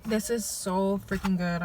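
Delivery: chewing while talking; talking through a mouthful; mouth full. Real, not acted. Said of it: "I'm sorry".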